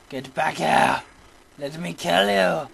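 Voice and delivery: loud, drawn-out call at a foe; loud gamer demand; frustrated shout. Real, not acted. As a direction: yell after a fleeing enemy. Chasing hidden enemy in game.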